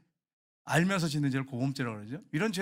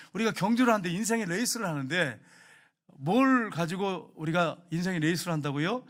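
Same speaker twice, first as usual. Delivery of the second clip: normal talking voice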